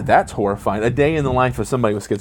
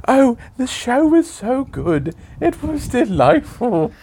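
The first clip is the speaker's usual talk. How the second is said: put-on high, slow posh British old-lady voice; mock an elderly British woman, way up high; old British lady impression; 13 semitones higher, slower than usual. Dad mocking daughter as a high, slow old British lady. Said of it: "But it's like".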